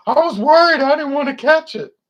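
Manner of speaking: high, loud, whiny fretting; mock worried. Nervous tester explaining why he got tested, high anxious whine. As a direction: squeal it like a nervous wreck afraid of germs. Acted testing dialogue, scared person.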